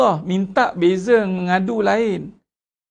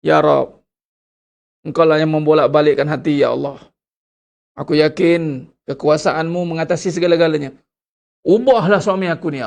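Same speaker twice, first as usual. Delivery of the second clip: louder, firm and sincere supplication; wife praying for her husband, louder and earnest; earnest prayer; 2 semitones lower, 5 dB louder than usual. Pretend. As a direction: pray with conviction, hands raised. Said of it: "Doa lah angkat tangan".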